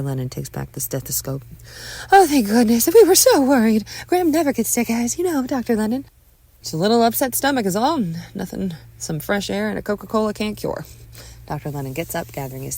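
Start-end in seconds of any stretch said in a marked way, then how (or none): 2.1-6.0 high, loud gush of relief; nervous mother's relief. A narrator's reading. Mother thanks doctor after exam, loud relieved gushing. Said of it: "Watching is a nervous Patricia". 6.6-10.8 lightly voiced doctor, pitched up; sweet older doctor. Doctor reassures worried parents, lightly voiced older man.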